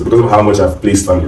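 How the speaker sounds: ordinary talk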